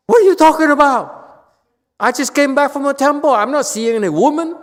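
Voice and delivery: loud, high, fast indignant shouting; defensive yelling. Retold or imitated. Husband yelling back at his angry wife. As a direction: bluster back like a guilty husband. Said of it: "He says".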